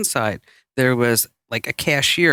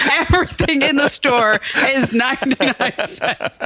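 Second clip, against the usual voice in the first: high, laughing loudspeaker call; mock store announcement; 8 semitones higher than usual. Cashier on the PA to two shoppers, laughing announcement. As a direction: announce to an empty store over the speaker. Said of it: "she gets on the P A".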